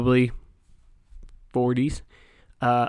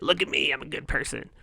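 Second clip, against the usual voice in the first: mocking self-praise; 2 semitones higher, 6 dB quieter than usual. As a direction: parody a show-off do-gooder posting online. Mocking show-off helpers online.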